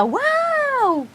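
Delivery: high gushing wow; mock delight. Showing the child how to look happy. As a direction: gush with delight. Exaggerated joy cue.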